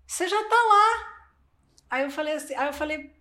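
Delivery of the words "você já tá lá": a hint of the coach, pitched higher and louder; mild imitation of a mentor speaking up; lightly voiced coach. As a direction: play the mentor reassuring her, raised up. Coach's advice voiced in retelling.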